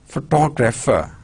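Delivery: pho-TOG-rapher; "photographer" stressed on its second syllable. Shown as a demonstration. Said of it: "Stress syllables, two".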